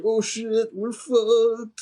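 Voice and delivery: high, drawn-out panicky wail; mock freak-out. [name] during the raid, mock freak-out. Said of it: "He's, like, really freaking out".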